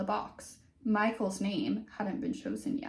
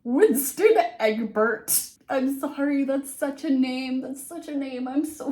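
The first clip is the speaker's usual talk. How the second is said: amused name reading; 5 semitones higher, 8 dB louder than usual. Real, not acted. Laughing at the name.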